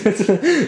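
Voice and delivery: a high, happy burst of laughter; giddy laughter; delighted laugh. Real, not acted. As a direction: laugh out the thrill. Delighted laugh after the pull.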